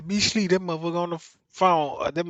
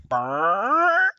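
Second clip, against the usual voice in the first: imitated fart; 6 semitones higher, 4 dB louder than usual. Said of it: "my ass said".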